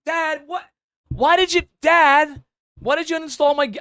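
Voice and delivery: high, loud, squeaky whine at dad; whiny teen son. Retold or imitated. Son complaining to dad his game got uninstalled, high indignant whine. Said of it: "and the son's like".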